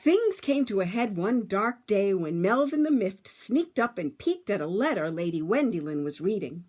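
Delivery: put-on lively read-aloud for children; storybook narration. A narrator's reading. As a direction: tell it like a bedtime story to a class.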